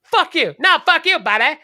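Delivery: high, loud cartoon Canadian shouting fuck you, buddy; South Park Canadian. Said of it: "like in South Park".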